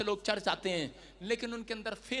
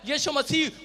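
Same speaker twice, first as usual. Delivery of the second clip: loud, high rallying sermon; full-force preaching; 6 semitones higher, 17 dB louder than usual. Acted. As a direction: preach it at a shout, stretching key words.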